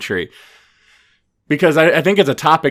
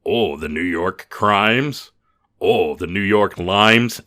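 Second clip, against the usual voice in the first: slow, drawn-out mocking voice; sneering Times hater; slower than usual. Voicing people who scorn the Times, slow mocking sneer. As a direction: stretch out each punning insult, derisively. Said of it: "percent of our country that's like".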